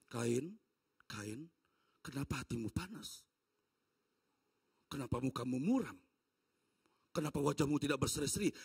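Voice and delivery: low, grave voice calling out a name; deep and searching, a father questioning; God calling Cain. Acted. God questions Cain about his anger, low and grave.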